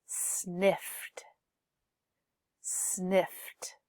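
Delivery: "Sniffed" in one syllable with an audible final T; final T sound. Shown as a demonstration. Teacher says it for students to repeat, "sniffed" closing on a clear T. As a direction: model "sniffed" twice, releasing the T after F. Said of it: "Can you hear the T?".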